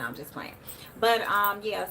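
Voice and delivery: usual speaking voice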